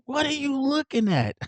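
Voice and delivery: high-pitched imitated confrontation; mock challenge. Tommy turning on the waiter, high mock challenge.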